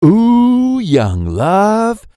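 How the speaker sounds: high, swooping teasing coo; teasing crowd murmur. A narrator's reading. Crowd gossiping about young love, teasing high coo. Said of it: "Cue the crowd whispering".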